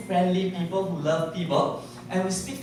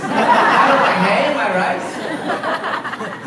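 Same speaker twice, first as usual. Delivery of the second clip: mocking rude complaint; 5 semitones higher, 6 dB louder, much slower than usual.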